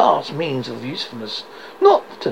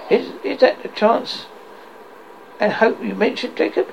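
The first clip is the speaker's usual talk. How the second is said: faltering voice; 6 semitones higher than usual. Acted. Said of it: "a faltering voice".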